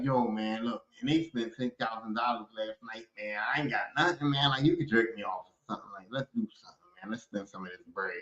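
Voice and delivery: put-on low, quiet pleading to a girl; mock hushed plea. Guy quietly begs his girl to spend money, low and hushed. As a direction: murmur it low like a secret ask.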